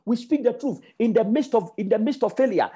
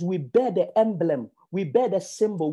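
normal talking voice

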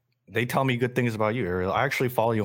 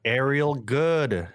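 announcer voice; 5 semitones higher, much slower than usual. Acted. Announcing the special guest.

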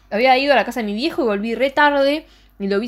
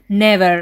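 NEVER punched louder; quoted never; 6 dB louder than usual. Retold or imitated. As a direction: hit never hard. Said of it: "le dice Santana".

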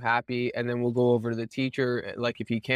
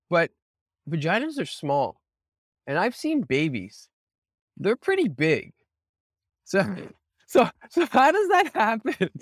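put-on higher child voice, rising into laughs at the end; imitating a curious little boy; 7 semitones higher, 4 dB louder than usual. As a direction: innocent puzzled kid asking mom. Son asking his mother about birth.

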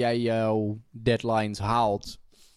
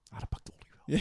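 whisper; 3 semitones higher, 6 dB quieter, faster than usual. Retold or imitated. Voicing own lazy thought.

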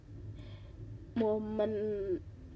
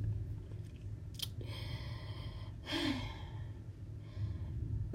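long heavy sigh; 5 semitones higher, 7 dB quieter than usual. Real, not acted. Before bad news.

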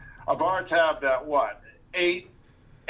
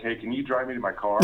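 ordinary delivery

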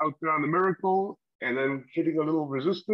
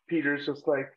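usual speaking voice

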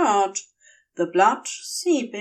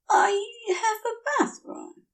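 dreamy angel; 12 semitones higher than usual. Acted. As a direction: gush a little, half-drunk. Woozy angel offers bathroom.

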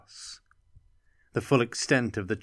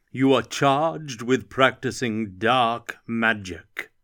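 slow, loud proclamation across a square; booming amplified voice; 5 dB louder than usual. Acted. Lerna's magically amplified summons to Anton, booming. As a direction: boom it out like a magic herald. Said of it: "a voice was booming out".

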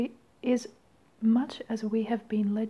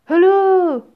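much higher, much louder hello; prim phone hello; 8 semitones higher, 14 dB louder than usual. Acted. Genie repeats phone greeting.

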